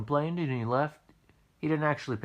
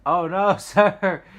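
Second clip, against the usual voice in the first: laughing it off; 9 semitones higher, 11 dB louder, much slower than usual. Retold or imitated. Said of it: "I start laughing at that".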